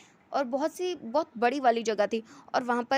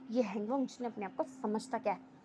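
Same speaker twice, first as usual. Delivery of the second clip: softer, under-the-breath resentment; inner thought; 2 semitones lower, 7 dB quieter than usual. A narrator's reading. Angry rival thinking about Hangong to himself, softly. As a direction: think it to yourself, voice dropped. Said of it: "ऐसा वो मन में सोच रहा था".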